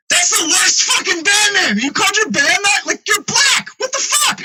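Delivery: hammed-up indignant shriek at a terrible band name; mock outrage. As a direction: squeal in retold disbelief at the band name. Retold outraged reaction to band name.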